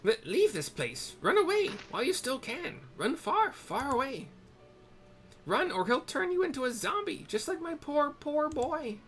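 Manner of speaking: put-on high, loud panicked old woman; frantic old hag. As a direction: shriek a warning like a terrified crone. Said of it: "Haggard hag".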